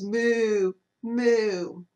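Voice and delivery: drawn-out lowing moo; lightly voiced cow moo. Cow noise heard by librarian.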